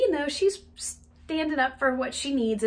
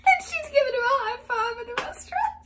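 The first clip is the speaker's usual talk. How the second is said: high, squeaky talk through laughter; helpless laughing; 14 semitones higher, 4 dB louder than usual. Real, not acted. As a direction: struggle to speak while cracking up. Cracks up at her own story.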